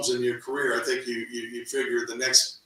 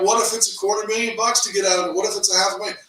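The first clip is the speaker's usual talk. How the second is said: alarmed; 8 semitones higher, 4 dB louder, faster than usual. Retold or imitated. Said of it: "And I go".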